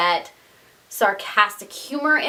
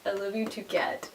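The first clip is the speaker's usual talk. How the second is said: much softer, tender words to a pet; tender to the cat; 3 semitones lower, 11 dB quieter than usual. Real, not acted. Vlogger fondly tells her clingy cat she loves it, much softer. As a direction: murmur fondly to the cat curled on you.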